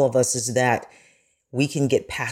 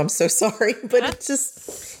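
getting emotional; 9 semitones higher than usual. Real, not acted. Journalist moved by Gene Roddenberry's legacy, voice catching. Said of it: "And I'm getting emotional".

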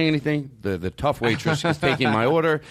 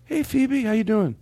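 talking to a pet; 14 semitones higher, slower than usual. Real, not acted. Talking to Phoebe like a pet, high and sweet. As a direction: coo at her like she's a kitten. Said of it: "I don't know why I'm talking to her like that".

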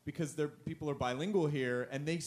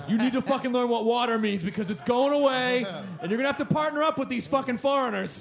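loud, high fearmongering rant; mock scare ad; 8 semitones higher, 10 dB louder than usual. Mock Rosetta Stone ad scaring people, shouted. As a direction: yell it like a panic ad. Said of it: "scare the shit out of people".

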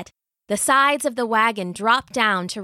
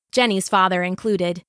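usual speaking voice

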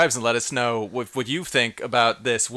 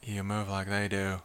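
emotionless; 5 semitones lower, 8 dB quieter than usual. Pretend. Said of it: "least amount of emotion".

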